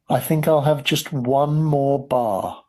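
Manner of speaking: ordinary delivery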